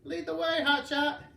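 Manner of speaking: raspy granny voice from the commercials; sandpaper voice. A narrator's reading. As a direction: croak it like a crusty old granny. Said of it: "in a sandpaper voice".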